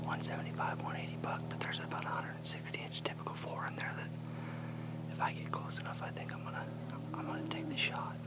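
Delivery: hushed hunter's whisper. Real, not acted. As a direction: whisper so the deer won't hear. Whispering while stalking deer.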